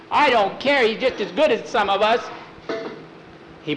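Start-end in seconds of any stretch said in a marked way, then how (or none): 0.1-2.2 put-on high, loud boyish defiance; defiant protest. Boy standing up for his dog, loud defiant protest. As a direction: fire back loudly, pitched up.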